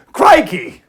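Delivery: high, loud hammed-up Aussie exclamation; over-the-top Australian accent; mock Aussie. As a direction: blurt it like a cartoon Australian. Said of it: "That's Aussie".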